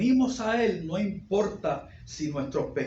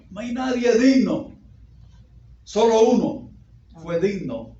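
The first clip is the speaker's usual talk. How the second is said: louder, higher, driving pulpit voice; full-force preaching; 5 semitones higher, 7 dB louder than usual. Acted. Pastor to church, preaching grace at full force. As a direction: proclaim it to the back pews.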